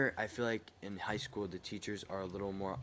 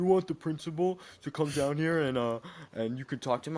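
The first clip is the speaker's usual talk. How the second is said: louder, higher mock teacher challenge; teacher's threat; 8 semitones higher, 7 dB louder than usual. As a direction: dare the student to face the principal.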